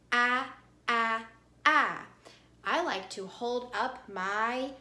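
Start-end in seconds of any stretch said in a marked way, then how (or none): 0.1-2.1 AH said as short A in apple, loud; short A sound. Shown as a demonstration. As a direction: punch out AH as the vowel of cat. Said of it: "Say it with me".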